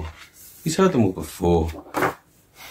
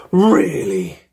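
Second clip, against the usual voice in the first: put-on amazed kids; 7 semitones higher, 5 dB louder than usual.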